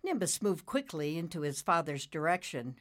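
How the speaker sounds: ordinary delivery, nothing marked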